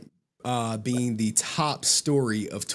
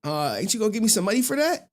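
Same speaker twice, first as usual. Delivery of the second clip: retold proud announcement, pitched up; eager, higher-voiced mock boast; excited grandkid; 10 semitones higher than usual. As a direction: play the hopeful kid angling for a reward. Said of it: "came home from college like".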